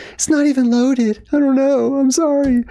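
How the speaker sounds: hammed-up frightened woman justifying herself; nervous excuse. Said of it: "And she's like".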